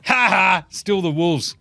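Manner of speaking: lightly taunting cackle of the basketball gods; a hint of gleeful mockery; teasing the Wolves from on high; mocking gods. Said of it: "the basketball gods are going".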